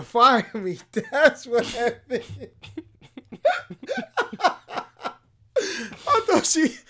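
words breaking into high laughter; can barely get it out for laughing; laughing through words. Real, not acted. Laughing while telling his friend about the boss.

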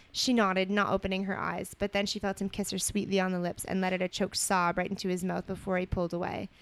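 measured storybook reading voice; story narration. A narrator's reading. Co-host reading romantic fan fiction aloud, story narration. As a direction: read it out evenly as narrative.

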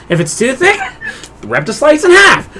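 ordinary talk, nothing marked

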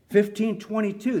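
ordinary talk, nothing marked

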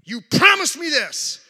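loud, high, slow cry of protest; retold screaming. Preacher retells his fight with God, screaming in protest. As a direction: re-enact yelling an accusation, drawing it out. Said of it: "I was screaming at God".

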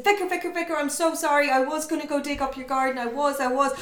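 high, fast, breathless pleading; frantic James. Retold or imitated. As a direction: rush the apology out in a panic. James babbling to vicar.

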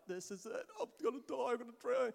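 put-on high, rising panic of a drowning child; reliving the terror of going under; panicked inner voice. Pastor retelling his childhood near-drowning to the congregation, high panic. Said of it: "Like, I'm like".

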